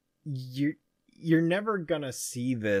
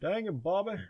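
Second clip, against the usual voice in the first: exasperated Texan dad; 6 semitones higher, 7 dB quieter than usual. Retold or imitated. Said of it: "good old Mike Judge".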